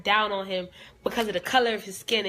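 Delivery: usual speaking voice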